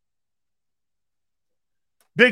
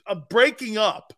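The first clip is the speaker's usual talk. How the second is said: ordinary delivery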